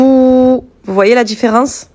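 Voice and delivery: ordinary talk, nothing marked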